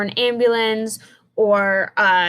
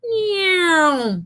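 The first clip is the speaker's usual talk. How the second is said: high zoom sliding down; car flying by, pitch dropping off; right falling car sound; 8 semitones higher than usual. Shown as a demonstration. Teacher demos the correct Doppler zoom, falling zoom. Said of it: "you hear it go".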